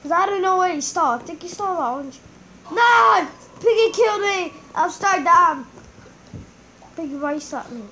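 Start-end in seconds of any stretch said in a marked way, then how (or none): 2.7-4.5 loud, high cry of dismay; real shock at losing; shocked yell. Real, not acted. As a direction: yelp it as you get caught. Just got killed in game.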